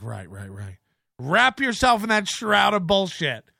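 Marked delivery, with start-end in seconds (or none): 0.0-0.8 muttered sarcastic aside. Real, not acted.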